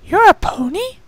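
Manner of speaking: high, wide-eyed changeling filly; surprised young changeling. A narrator's reading. Changeling filly meeting her first pony, amazed. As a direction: ask it in open wonder. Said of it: "Pupa blinked".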